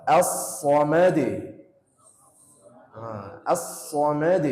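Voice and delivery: slow, drawn-out As-samad with the d bounced; slow recitation. Shown as a demonstration. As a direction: stretch As-samad and pop the d.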